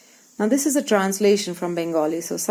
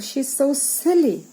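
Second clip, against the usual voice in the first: put-on scornful boy; 6 semitones higher than usual. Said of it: "So he says".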